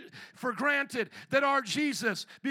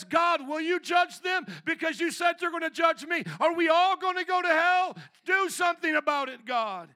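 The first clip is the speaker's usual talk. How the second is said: heated taunt, pitched up until the last word; mocking accuser; 4 semitones higher, 5 dB louder than usual. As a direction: challenge God like a scoffing prosecutor. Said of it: "He mocks God".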